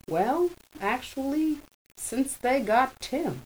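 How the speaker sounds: high, slow voiced brother; a lifted, drawn-out character voice; Hammy's voice. A narrator's reading. Hammy explains his change.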